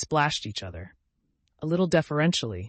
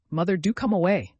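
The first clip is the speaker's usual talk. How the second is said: urging; 5 semitones higher, 6 dB louder than usual. A narrator's reading. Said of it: "said Lucy".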